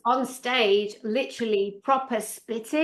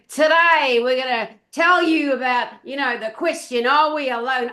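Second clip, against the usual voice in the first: loud, raised announcer send-up; mock TV presenter; 2 semitones higher, 5 dB louder than usual. Mimicking News Nation presenters teasing the alien question, mock announcer. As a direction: parody a news programme's grand opening question. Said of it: "and they go".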